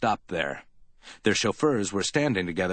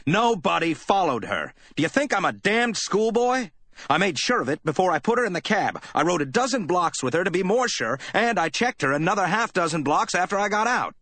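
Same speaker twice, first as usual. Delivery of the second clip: higher and raised, hammering it out; loud, savage voice; 10 semitones higher than usual. A narrator's reading. Spade snapping at Effie's doubt, loud and savage. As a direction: tear into her, savage and loud. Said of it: "in a loud, savage voice".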